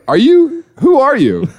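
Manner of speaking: high alarmed voice of a baffled husband; confused husband. Pretend. Husband finds a stranger in his house, high and alarmed. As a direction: squeak it out, totally thrown.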